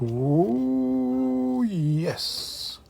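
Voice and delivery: high, drawn-out ooh of delight; pleasantly surprised at a find. Real, not acted. Browsing old records, spots a familiar album, delighted ooh.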